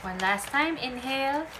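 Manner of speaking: slow, calm breathing cues; guided breathing. Acted. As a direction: lead the breath gently. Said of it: "please inhale with me".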